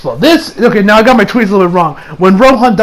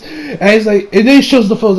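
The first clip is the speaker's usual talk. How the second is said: ordinary talk, nothing marked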